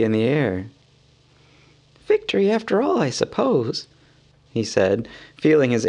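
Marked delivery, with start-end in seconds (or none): 2.1-3.9 high, light hobbit voice; a small, fretful hobbit muttering; Bilbo voice. A narrator's reading.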